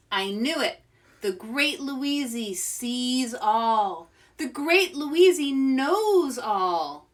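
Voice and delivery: boastful seer. Acted. Louise boasts grandly.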